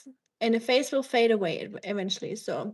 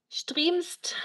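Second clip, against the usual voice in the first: whisper; 6 semitones higher than usual. Real, not acted. Sudden whisper to chat.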